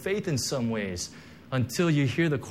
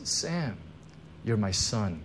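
hushed, lower and much softer; voice of God; 5 semitones lower, 7 dB quieter than usual. Retold or imitated. Pastor retelling God's words to him, hushed and tender. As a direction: say it gently, like a voice from heaven. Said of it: "And the Lord says".

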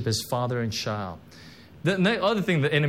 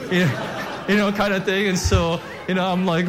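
laughing through the words; 5 semitones higher, 4 dB louder than usual. Real, not acted. Pastor laughing as he recalls his old-school pastor's prayer, laughing. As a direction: giggle while you say it.

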